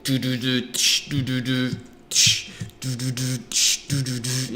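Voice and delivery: imitating rock and roll with do syllables and tsh cymbal hits; mock rock soundtrack for a welding montage; vocal rock riff. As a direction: scat a little rock and roll montage beat. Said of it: "rock and roll music".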